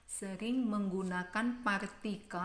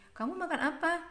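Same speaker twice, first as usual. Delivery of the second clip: high question with "apa" lifted; rising question; 8 semitones higher, faster than usual. Shown as a demonstration. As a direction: demonstrate the raised ending on "apa". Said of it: "nadanya Mem Ita di belakang meninggi".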